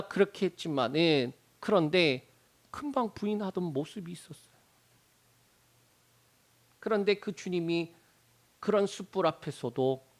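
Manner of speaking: ordinary talk, nothing marked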